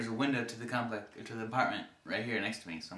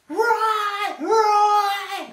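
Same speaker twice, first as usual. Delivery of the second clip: very high, very loud screaming of the name; bloody murder scream; 13 dB louder than usual. Retold or imitated. Woman behind the apartment window, imitated high terrified scream. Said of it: "It's bloody murder".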